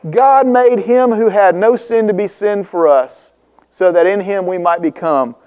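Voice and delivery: ordinary talk, nothing marked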